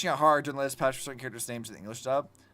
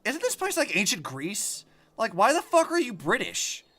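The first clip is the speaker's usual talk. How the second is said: incredulous, rising high and louder toward the end; mock exasperation; 6 semitones higher, 5 dB louder than usual. Streamer mocking the game's voice acting, incredulous. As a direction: throw up your hands at the game's accents. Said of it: "and I'm like".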